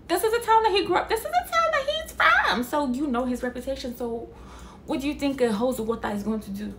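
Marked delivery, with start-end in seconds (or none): 0.1-2.6 much higher and louder, fast exasperated outburst; rising up in disbelief. Real, not acted. Yelling at townsfolk who should know his reputation, exasperated.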